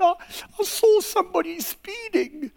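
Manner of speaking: high, tearful mock wail of a policeman; hammed-up crying policeman; mock sobbing. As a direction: sob it out like a weeping cop. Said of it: "he is weeping and sobbing".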